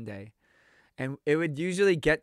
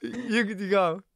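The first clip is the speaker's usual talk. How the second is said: slow mock Russian go-ahead; a lightly put-on Russian accent; 4 semitones higher, slower than usual. The Russian dude agreeing to help, mock accent. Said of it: "he goes".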